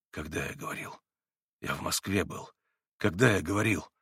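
faint, dazed babble; lost babble. A narrator's reading. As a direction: mumble it, utterly lost. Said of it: "Совсем потерянно пролепетал Иван".

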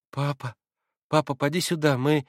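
put-on a dying boy calls his father; agitated babbling child. A narrator's reading. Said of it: "пролепетал было Илюша в чрезвычайном возбуждении". (лепеча в волнении)

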